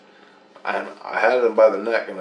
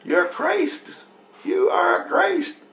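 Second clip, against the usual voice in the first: put-on very high child voice; imitated young daughter; slower than usual. Daughter telling dad who he is, high child voice. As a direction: put on a high little-girl voice, insistent. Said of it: "one of my daughters go".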